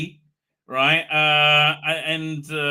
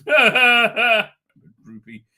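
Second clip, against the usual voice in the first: slow, louder mock whimper through a laugh; half-laughing fake cry; 6 semitones higher, 9 dB louder, much slower than usual. Shown as a demonstration. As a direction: draw it out like a hammed-up sob. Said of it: "She cried".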